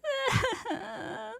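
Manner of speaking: put-on high sobbing girl; crying sob. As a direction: break into a wail. Senpai cries even louder.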